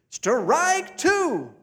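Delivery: high, loud umpire call; a kid calling strike two. Acted. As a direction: bark it like an umpire. Boy calls strike, high.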